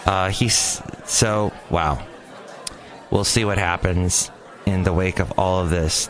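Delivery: normal talking voice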